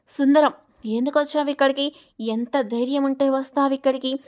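ordinary delivery

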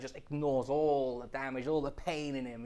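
ordinary talk

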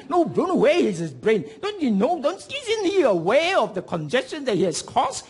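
high, incredulous rant; mock exasperation. Onlooker complains about driver causing congestion, mock exasperated scolding.

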